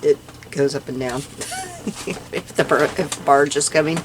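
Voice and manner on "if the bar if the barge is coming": usual speaking voice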